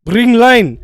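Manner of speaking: a coworker demanding line, mock command; insistent mock foreman. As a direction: bark bring line like a bossy coworker. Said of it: "this motherfucker was like".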